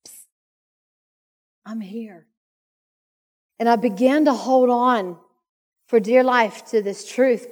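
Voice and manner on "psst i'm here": soft hushed Psst, much quieter; a still small voice, barely spoken; gentle whisper. Retold or imitated. Holy Spirit reassuring the overwhelmed mother, hushed and soft.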